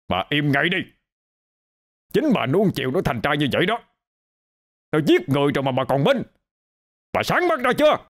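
high, fast, scolding man's voice; harsh fast rebuke ending on a high shout; angry husband. Acted. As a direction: snap at the wife to shut up. Husband scolds wife angrily.

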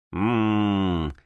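long low grumbling "mmm"; displeased hum. A narrator's reading. Thief finds the desk magically sealed, displeased low hum. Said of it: "недовольно промычал вор".

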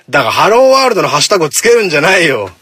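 loud, high mock telling-off; exasperated scolding. Host telling listeners off over the hashtag, exasperated scolding.

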